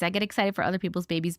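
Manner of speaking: ordinary talk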